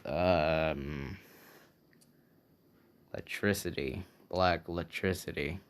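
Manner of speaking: a moody hero trying to sound dark and mysterious; said oddly. Acted. Classmate announcing his quirk, in a low brooding mock-mysterious voice. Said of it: "Why are you saying it like that".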